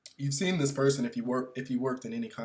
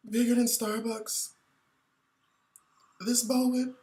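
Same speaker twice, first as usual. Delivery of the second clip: milk it like an awards speech; mock acceptance speech; 10 semitones higher than usual. Comedian parodies a tearful awards speech.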